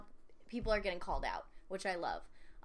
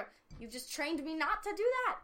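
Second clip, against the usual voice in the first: exasperated groan; 9 semitones higher, 4 dB louder than usual. Retold or imitated. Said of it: "And I'm like".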